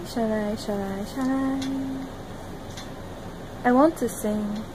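usual speaking voice

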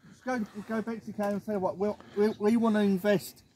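normal talking voice

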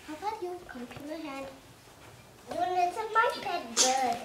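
usual speaking voice

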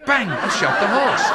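laughing punchline. Real, not acted.

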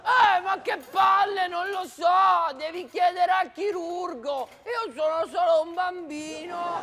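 loud bratty complaint; whiny angry shout. Acted. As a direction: yell back like an annoyed kid. Kid snapping back at mother.

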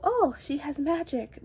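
lightly high, dismissive take on Oh, she has magic; mock typical phrasing. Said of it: "it's not the typical".

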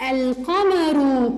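القمر very slow with a clear lam; stretched القمر with the l voiced; slow moon-letter demo. Shown as a demonstration. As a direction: drag out القمر as al-qamaru. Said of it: "هل لفظنا اللام هنا".

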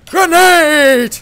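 loud, high yell of warning; shouted warning. Real, not acted. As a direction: bellow the warning at your teammate. Warning teammate during a game.

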